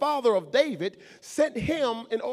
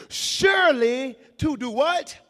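call for response; 3 semitones higher, 5 dB louder than usual. Shown as a demonstration.

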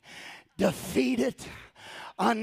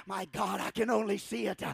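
ordinary delivery

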